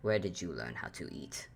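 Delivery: low, weary, exasperated voice; exasperated Antler. Acted. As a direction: sigh it out, fed up. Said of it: "before just sighing and goes".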